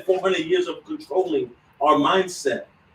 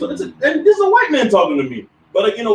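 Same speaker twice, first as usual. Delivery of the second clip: usual speaking voice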